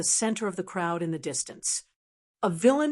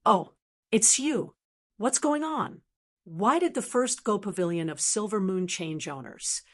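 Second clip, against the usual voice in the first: ordinary delivery, nothing marked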